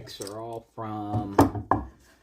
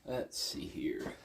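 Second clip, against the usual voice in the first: whispering to himself; 5 semitones lower, 10 dB quieter, slower than usual. Real, not acted. Muttering to himself.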